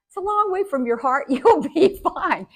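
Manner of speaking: high, breezy reassurance with a laugh; hammed-up imitation of an unworried father; dismissive dad. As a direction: wave the injury away cheerfully. Dad brushing off her injury.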